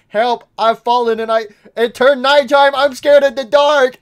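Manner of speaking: mock scared. Gamer voicing fallen Mimic at nightfall, hammed-up scared cries. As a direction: wail it like a kid afraid of the dark.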